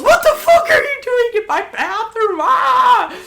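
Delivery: outraged high shriek, acted out; Hannah. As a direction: shriek the question, ending in a scream. Acts Hannah yelling at her.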